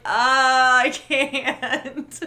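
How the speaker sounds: hammed-up wail, close to laughing; mock wail. Host retelling blacking out at the screen, mock wail. Acts out her refusal to watch.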